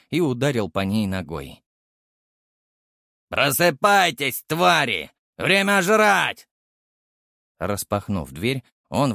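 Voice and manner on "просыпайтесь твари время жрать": loud, slow jailer's bellow; shouting. A narrator's reading. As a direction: roar at the caged beasts. Guard bangs door, wakes beasts.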